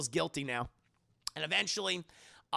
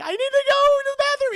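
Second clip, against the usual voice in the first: much higher, louder, strained voice about to cry; choked-up high squeak; 12 dB louder than usual. Retold or imitated. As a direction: squeeze it out before the tears come. Danny fleeing, about to cry.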